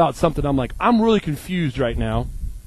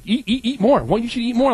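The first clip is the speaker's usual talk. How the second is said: mock pushy host; 9 semitones higher than usual. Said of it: "or they're like".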